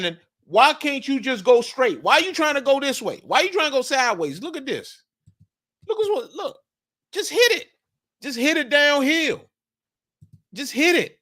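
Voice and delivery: high-pitched exasperated coach yelling at his runner; imitating an upset position coach, pitch way up; frustrated running back coach. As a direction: scold the back for not going straight. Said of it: "If I'm the running back coach, I'm upset. I'm saying".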